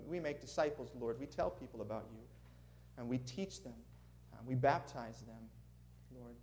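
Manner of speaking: normal talking voice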